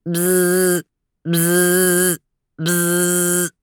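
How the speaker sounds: buzzer noise. Acted. Buzzer imitated.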